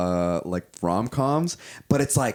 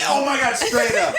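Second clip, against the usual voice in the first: high laughing squeal; cracking up at the joke; laughing delight; 6 dB louder than usual. Real, not acted.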